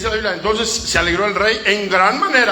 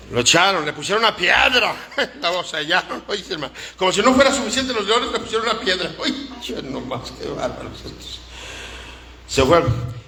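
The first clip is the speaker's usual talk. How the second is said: ordinary delivery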